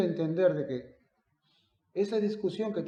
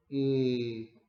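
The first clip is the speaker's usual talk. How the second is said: ordinary delivery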